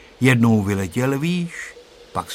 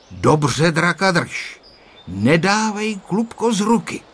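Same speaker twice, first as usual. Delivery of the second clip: stern; 6 semitones higher, 3 dB louder than usual. A narrator's reading. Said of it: "řekl mu přísně".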